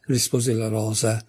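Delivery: ordinary delivery, nothing marked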